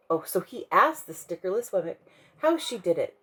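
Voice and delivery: ordinary delivery, nothing marked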